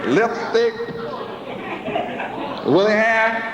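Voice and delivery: ordinary talk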